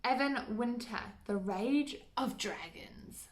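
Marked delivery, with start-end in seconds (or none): 1.3-3.3 slow breathy whisper on the title; playful mock-ominous whisper; dramatic whisper. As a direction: hush it like a spooky secret.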